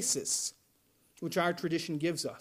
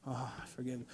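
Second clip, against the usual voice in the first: mumbled, much softer, grudging; grudging mutter; 8 semitones lower, 14 dB quieter, faster than usual. Retold or imitated. Congregant mimicking a reluctant forgiver, muttered low and soft. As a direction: drop to a low, tiny begrudging mumble. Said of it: "and you're like".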